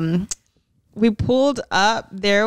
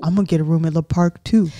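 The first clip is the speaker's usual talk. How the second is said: mock Zach, quick; 5 semitones lower, faster than usual. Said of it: "Zach was like".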